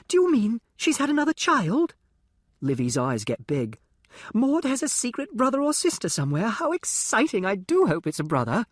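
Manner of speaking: put-on high, eager well-bred girl's voice; a sheltered rich girl thrilled by gossip, pitched high; posh young girl.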